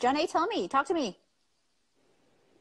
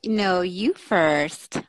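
teasing drawl; 5 semitones lower, 7 dB louder, much slower than usual. Real, not acted. Teasing banter on stream.

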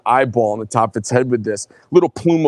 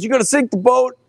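mild mock yell, pitched much higher; half-shouted warning, only mildly raised; retold yell; 10 semitones higher than usual. Friend on the boat warning the speaker, mild retold higher yell. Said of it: "And he yells at me".

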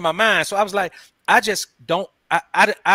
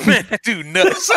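talking through helpless laughter; real laughter; 7 semitones higher, slower than usual. Real, not acted. Co-host reacting to the restaurant confession, laughing hard. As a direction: crack up while getting the words out.

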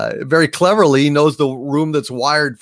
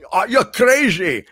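Connery quote; 6 semitones higher than usual. Retold or imitated. Quoting Connery's line.

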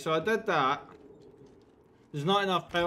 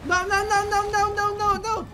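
high, loud frantic cries of no; panicked no; 13 semitones higher, 4 dB louder than usual. Real, not acted. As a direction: panic as it all goes wrong. Something goes wrong in game.

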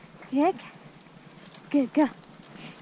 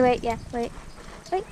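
usual speaking voice

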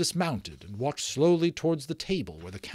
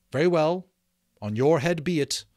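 raised, solemn pronouncement; 5 semitones higher, 6 dB louder than usual. A narrator's reading. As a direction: give a solemn, louder warning.

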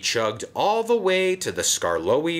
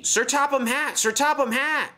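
high urgent calls; crying out; 3 dB louder than usual. Acted. Station master calls out urgently to Sir Topham Hatt. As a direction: call for the boss in a hurry. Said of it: "cried the station master".

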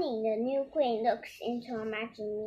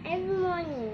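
ordinary delivery

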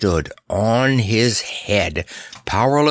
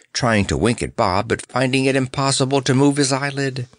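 plain reading voice

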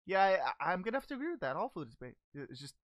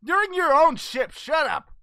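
loud, higher-pitched telling-off; exasperated retort; 4 semitones higher, 11 dB louder than usual. Acted. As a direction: shout down the complainer. Host snaps back at captive's complaint.

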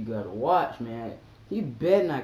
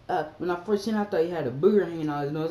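ordinary talk, nothing marked

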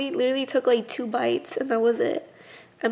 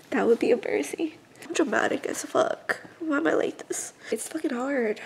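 ordinary talk

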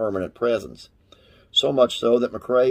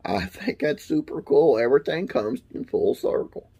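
ordinary delivery

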